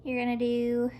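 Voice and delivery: usual speaking voice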